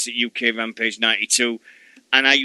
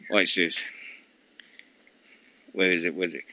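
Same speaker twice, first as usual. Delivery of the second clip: conspiratorial whisper; 7 semitones lower, 8 dB quieter, faster than usual. Real, not acted. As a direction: let him in on the prank softly. About to wind her up.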